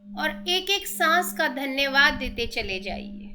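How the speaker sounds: slow, drawn-out meditation instructions with long pauses; unhurried breathing guidance, lots of space between phrases; guided meditation. Acted. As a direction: lead them gently through breathing and relaxation.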